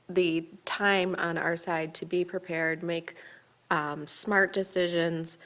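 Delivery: ordinary talk, nothing marked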